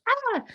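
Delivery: short high-pitched mock scream; alarm cry. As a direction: yelp as the nervous system panics. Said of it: "Your nervous system goes".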